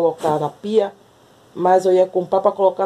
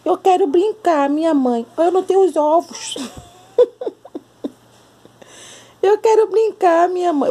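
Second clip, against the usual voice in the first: high, squeaky cat voice wanting to play; voicing the cat; 9 semitones higher than usual. Voicing the cat begging its mom to play, high squeaky voice. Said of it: "por que você fala com essa voz tão ridícula".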